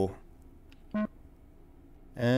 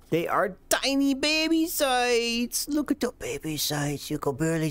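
put-on cutesy baby talk; 9 semitones higher than usual. Cooing over tiny sights.

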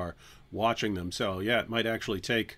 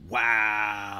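drawn-out wow; 2 semitones lower than usual. Pretend. Mock reaction to videos gone.

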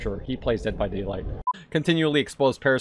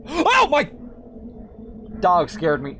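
ordinary delivery

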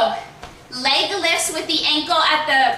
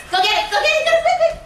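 excited cheering; 13 semitones higher, much faster than usual. Real, not acted. Coach cheering kids on.

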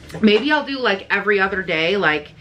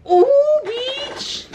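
excited squeal. Real, not acted. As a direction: squeal with delight at the package. Unboxing a long-awaited product.

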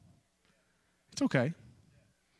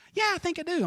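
light, much higher mock mom voice; imitating his mom; faster than usual. Son retelling his mom's phone answer, higher mock mom voice. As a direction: squeak it out like his mother. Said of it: "She goes".

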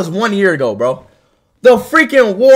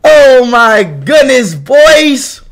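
hyped stream greeting; much slower than usual. Real, not acted. Streamer opens the show to his chat, hyped. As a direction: greet the crowd with big, stretched words.

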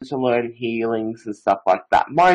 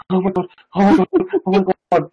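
gasping, higher-pitched mock panic; re-enacted panic; 7 semitones higher, faster than usual. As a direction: act out a startled fright with a gasp. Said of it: "and I'm panicking".

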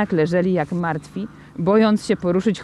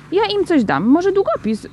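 put-on high-pitched little boy making a noble offer; self-sacrificing child; 9 semitones higher, 3 dB louder than usual. A narrator's reading. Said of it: "z poświęceniem". (dziecięcy ton pełen poświęcenia)

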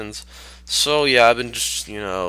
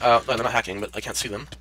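ordinary talk, nothing marked

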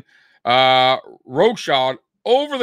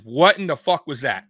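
raised, louder furious coach rant; pretend chewing-out; 5 semitones higher, 3 dB louder than usual. Head coach scolding special teams after the game, angry raised rant. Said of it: "after that game and said".

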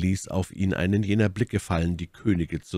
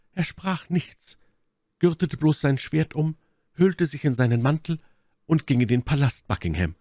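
hohe, schwärmerisch erzählende Frauenrolle; Milady, eindringlich; 10 semitones higher than usual. Acted. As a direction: Felton mit hoher Stimme vom edlen Bräutigam erzählen. (eindringliche, hohe Stimme der Milady)

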